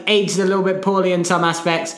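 ordinary talk, nothing marked

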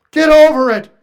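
a sharp, loud rebuke to the emotional; forceful rebuke. Real, not acted. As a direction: bark it at the congregation. Preacher scolding.

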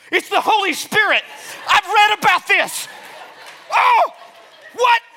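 high, loud, thrilled cry of discovery; excited shout. Retold or imitated. Retelling his awe at a rushing wind, loud excited shout. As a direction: shout it out as if the Holy Spirit just arrived. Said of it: "I'm like".

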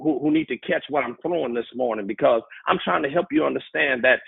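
ordinary talk

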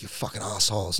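ordinary talk, nothing marked

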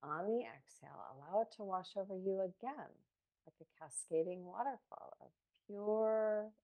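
soft, unhurried guided meditation voice; calm meditative tone. Real, not acted. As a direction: ease them gently into each breath. Leads a guided breathing meditation.

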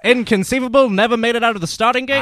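high, loud race call; race caller. Acted. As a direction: shout it from the booth. Race call with movie quote.

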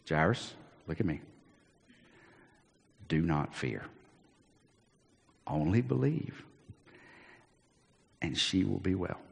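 soft, low and tender; calm him with quiet certainty; reassuring. Acted. Jesus reassures grieving father.

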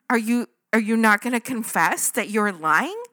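loud, disbelieving question; imitated exasperated prodding; incredulous challenge. Imagined challenge to lying sister.

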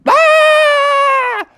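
loud, very high held shriek; piercing cartoon scream; crowd scream. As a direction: scream out in comic terror. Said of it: "So they all screamed".

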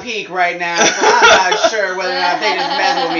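laughing. Real, not acted. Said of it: "I just hit my peak right now".

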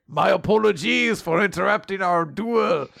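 theatrical French apology; French accent. Acted. Charles excusing himself to his duel opponent, French accent. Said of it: "is apparently very French".

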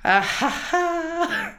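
slow, high, drawn-out fake laugh; a slow, high, hammed-up ha-ha-ha; mock laugh. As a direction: laugh at your own joke in a hammed-up way. Mock laugh at her own joke.

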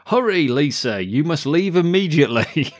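imitating a movie hero's urgent shout; mock Bill and Ted shout. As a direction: bellow it like a cheesy action hero. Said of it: "Keanu Reeves shouts in his big Bill and Ted moment".

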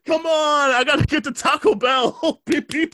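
high-pitched whiny plea breaking into laughter; hammed-up impatience with imitated horn beeps; mock honking driver. Driver desperate for Taco Bell, honking in mock impatience.

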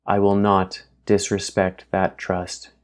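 slow, measured promise; slow it down, sincere. Acted. Alvis promising Grace to keep her trust, slow and measured. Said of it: "his vocal cadence slower".

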